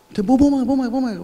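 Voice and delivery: high, urgent hurry-up call; re-enacted calling out; retold shout. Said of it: "ואני צועק להם".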